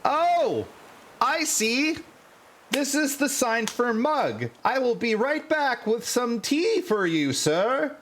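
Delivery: very high-pitched, obliging sidekick; high deferential voice, innocently eager to serve; Wutso. Acted. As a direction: pitch it way up as a servant happily taking an order. Sidekick misunderstands, offers tea.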